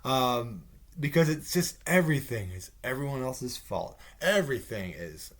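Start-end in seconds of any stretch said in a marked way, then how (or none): none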